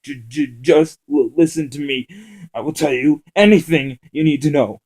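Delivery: stammering captive begs to be heard; panicked pleading. Acted. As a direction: stumble over the words begging. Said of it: "his voice less groggy".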